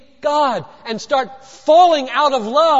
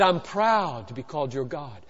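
low, soft statement of being proud; hushed, low-pitched avowal; quiet declaration; 9 semitones lower, 8 dB quieter than usual. Acted. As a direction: say it gently, well under full voice. First of two contrasted takes.

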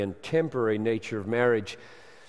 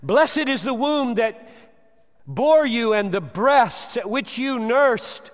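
woman crying out; 11 semitones higher, 9 dB louder, slower than usual. Acted. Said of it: "a woman cried".

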